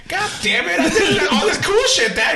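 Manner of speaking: frustrated mock complaint. Son frustrated dad only cares about losing, loud whine. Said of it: "And I'm all like".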